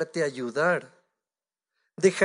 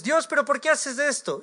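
higher-pitched mock protest; 7 semitones higher than usual. Believer questions God about what happens, protesting.

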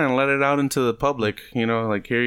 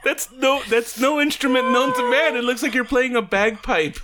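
laughing; 8 semitones higher than usual. Real, not acted. Laughing at the noise.

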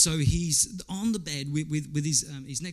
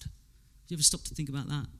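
ordinary delivery

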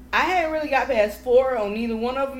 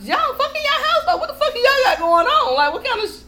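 shocked disbelief; 13 semitones higher, 6 dB louder than usual. Retold or imitated. Retelling shocked reaction at sister's house.

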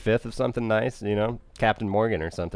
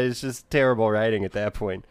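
ordinary delivery

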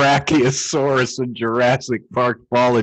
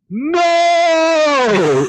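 high, loud cry of no; shouted no; 4 dB louder than usual. Retold or imitated. Reading a Shrek quote aloud, a high shouted no. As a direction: yell it out in despair. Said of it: "one of the quotes is just".

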